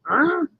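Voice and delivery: a single high rising growl. Acted. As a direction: yip it like a cub. Wolf boy growls again.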